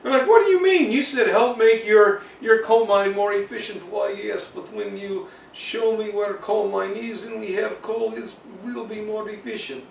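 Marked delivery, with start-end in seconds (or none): 0.3-3.8 high, incredulous mock engineer; baffled protest. Baffled engineers quarrel with Peter. 3.9-9.8 soft, higher mock Russian accent; Peter. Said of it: "will talk with Russian accent".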